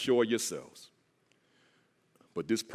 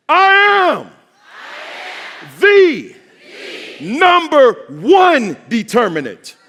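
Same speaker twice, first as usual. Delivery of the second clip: full-force high preacher's shout; thunderous, drawn-out chant lead; roof-raising shout; 12 semitones higher, 15 dB louder, slower than usual. Real, not acted. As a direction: scream each phrase slowly for the crowd to echo. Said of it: "take this roof right off of this".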